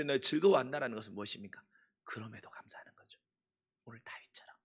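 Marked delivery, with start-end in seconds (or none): 2.0-4.6 whisper. Real, not acted. Soft aside in sermon.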